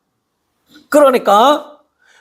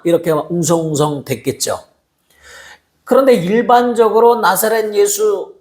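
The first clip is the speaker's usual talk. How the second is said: normal talking voice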